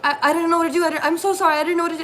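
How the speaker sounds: high, loud, breathless panic; panicked apology. Retold or imitated. New coworker frantically apologizing about the flood, panicked. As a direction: blurt it out like a frightened new hire. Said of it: "like, freaking out".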